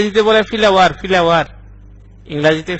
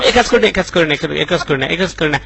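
very fast, repetitive begging; scared pleading; 2 semitones higher, much faster than usual. Acted. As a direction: plead frantically, words tumbling over each other. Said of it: "আমি খুব ভয় পাইছি". (ভয়ে তাড়াহুড়ো করে মিনতি)

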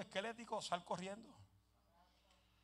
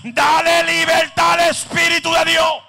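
loud, higher preaching shout; fiery preaching; 6 semitones higher, 24 dB louder than usual. Acted. The preacher shouts at the congregation to move freely. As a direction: exhort the congregation at full voice.

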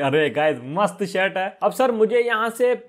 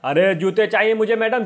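usual speaking voice

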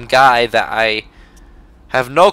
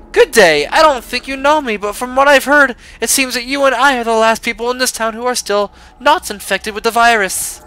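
a lightly put-on British lady voice, a touch higher; mild British accent; 7 semitones higher than usual. Reading pastry owner Charlotte's letter, mild put-on British accent. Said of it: "My British accent is not on par today".

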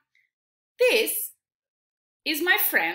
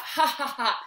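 laughing ha ha ha; 2 semitones lower than usual. Shown as a demonstration. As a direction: show them what a happy laugh sounds like. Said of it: "You laugh".

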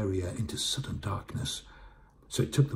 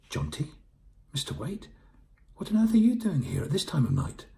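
tag; 9 semitones higher, 5 dB louder than usual. Acted. Elizabeth surprised by late callers.

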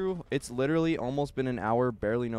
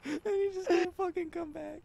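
mock crying; 3 dB quieter than usual. Mock whine, high, crying.

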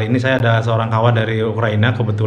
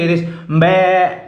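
mbe said slowly and higher, like a bleat; goat bleat imitation; 4 semitones higher, slower than usual. Shown as a demonstration. Host demonstrates the Indonesian goat sound, slow bleat. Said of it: "Here in my country".

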